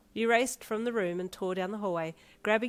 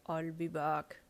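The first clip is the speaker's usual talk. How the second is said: lightly done robotic tough-guy impression; Terminator; much slower than usual. A narrator's reading. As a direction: imitate an action-film cyborg. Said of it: "imitating the Terminator voice".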